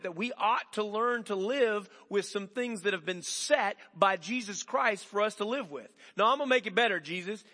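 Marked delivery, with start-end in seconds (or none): 6.2-7.4 loud, high insistent protest; stubborn protest. Retold or imitated. Himself arguing back at Jesus, loud stubborn protest. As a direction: dig in and refuse, louder.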